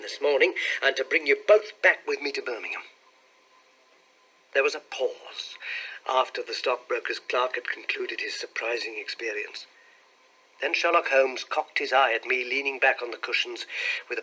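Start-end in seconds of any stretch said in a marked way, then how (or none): none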